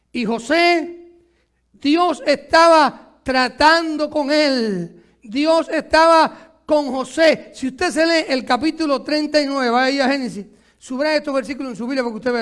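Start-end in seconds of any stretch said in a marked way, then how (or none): 0.1-7.5 very slow, weighty sermon lines; slow preaching. Acted. Pastor stressing God with José, slow preaching. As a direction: let each word land on the church.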